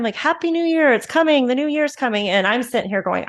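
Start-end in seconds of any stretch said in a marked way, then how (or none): 0.1-2.3 bright, high mock cheer, lightly hammed up; mock party cheer. Partying friends shouting the countdown, in mock high cheer. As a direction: imitate friends cheering the new year, pitched up.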